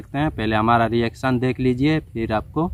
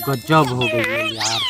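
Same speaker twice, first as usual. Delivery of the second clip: mock shock; much slower than usual. Shocked exclamation to friend.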